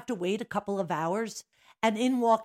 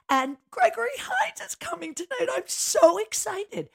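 retold excitement; 12 semitones higher, 5 dB louder than usual. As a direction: drag it out like a giddy fan. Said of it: "I said".

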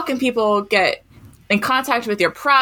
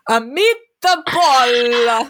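drawn-out, louder mock-Italian pronouncement; child's mock Italian; 3 dB louder, much slower than usual. Kindergartner showing off pretend Italian to teacher, slow and grand. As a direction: stretch each word grandly, as fake Italian. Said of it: "and I quote".

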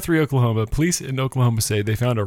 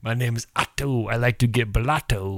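Otto from the Simpsons. Retold or imitated. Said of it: "Little Simpsons there for you".

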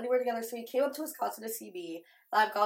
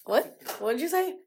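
surprised; 6 semitones higher, 3 dB louder than usual. Retold or imitated. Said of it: "And I was like".

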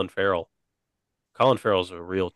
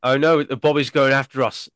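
put-on cockney-style British accent, a bit higher; hammed-up British accent; mock British accent; 4 semitones higher, 4 dB louder than usual. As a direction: play a panicky British crook. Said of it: "the British have all the best sayings".

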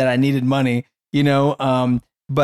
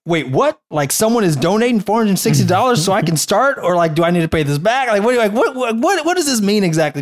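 incredulous questions; 6 semitones higher than usual. Retold or imitated. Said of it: "that's literally the way I replied".

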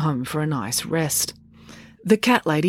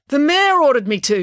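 louder high blurt; blurted confession; 9 semitones higher, 5 dB louder than usual. A narrator's reading. Constable blurts out his confession, louder. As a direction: let the confession burst out. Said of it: "he confessed".

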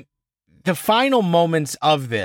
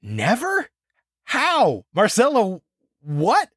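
incredulous; 4 semitones higher, slower than usual. Real, not acted. Incredulous reaction to twist.

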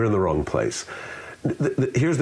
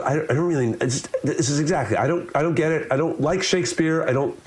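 higher-pitched, stammering mock confusion; baffled listener; 7 semitones higher than usual. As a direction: play a frustrated listener who doesn't get it. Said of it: "the listener goes".

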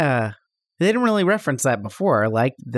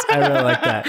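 laughing; 13 semitones higher than usual. Real, not acted. Laughing while praising the scene.

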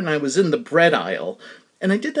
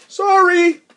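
high, louder called-out sorry; shouted sorry; 14 semitones higher, 5 dB louder than usual. Retold or imitated. Embarrassed man fleeing, calling back a high sorry. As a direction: call it back while hurrying off. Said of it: "And he shouted".

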